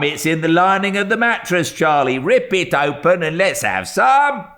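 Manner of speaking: high, loud jeering shouts; taunting boys. A narrator's reading. Street boys taunting the old dealer about his gold, jeering shouts. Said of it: "shouting that legend".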